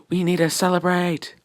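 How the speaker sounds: acted motherly excitement, pitched high; excited exclamation. Inko to her son on his news, high excited voice. Voicing Inko's joy at the news.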